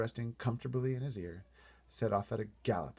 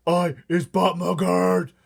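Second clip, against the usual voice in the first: higher, much louder, slow screeching giant; giant's screech; 9 semitones higher, 15 dB louder, slower than usual. Acted. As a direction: screech it like a furious young giant. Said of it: "screeched the butcher boy".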